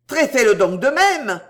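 loud, high, strident demand; piercing, insistent challenge at full voice. A narrator's reading. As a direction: yell the dare stridently. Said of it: "d'une voix encore plus stridente".

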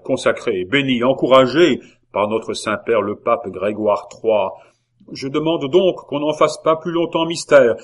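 forceful, emphatic address to the League; zealous orator. Acted. La Hurière urges signing and purge, emphatic oratory.

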